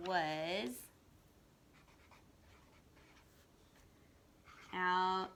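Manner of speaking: one word at a time with long pauses; reading a sentence slowly, word by word. Shown as a demonstration. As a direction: read word by word, slowly and softly. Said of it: "by slowing down and stretching out the word".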